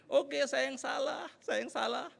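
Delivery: mock-meek high voice, stretched out; hasty apology. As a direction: give in sweetly, drawing out the words. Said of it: "terus langsung saya gini". (pura-pura mengalah, nada tinggi)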